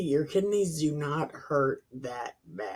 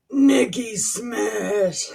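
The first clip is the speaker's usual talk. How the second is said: mock Hulk catchphrase; 5 semitones higher, 4 dB louder, much slower than usual. Own Hulk catchphrase, slow.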